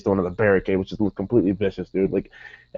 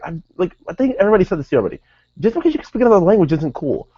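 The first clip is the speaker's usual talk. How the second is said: high, mock fed-up lecture; imitated exasperation; 8 semitones higher, faster than usual. Retelling Kevin scolding Del Rio, high imitated exasperation. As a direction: imitate someone pitched up and fed up.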